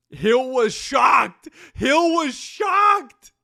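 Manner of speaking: high, loud, slow hammed-up shout; mock shout. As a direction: belt it out in mock outrage.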